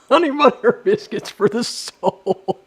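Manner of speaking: slow, laughing through the words; giggling at his own joke; real laughter while speaking. Real, not acted. Cracks himself up.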